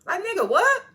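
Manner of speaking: loud, high-pitched disbelief; incredulous outburst. Real, not acted. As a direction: burst out, high and indignant. Indignant reaction to Phil's question.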